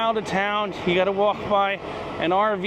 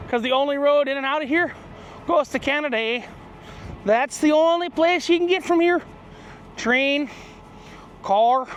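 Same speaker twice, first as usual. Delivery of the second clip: lightly put-on Canadian accent, pitched higher; 6 semitones higher than usual. Traveller describing the only road out, mock Canadian accent. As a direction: slip into a playful Canadian lilt with a tagged-on eh. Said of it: "I'm sounding like a Canadian".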